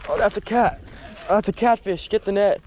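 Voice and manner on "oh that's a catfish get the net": ordinary talk, nothing marked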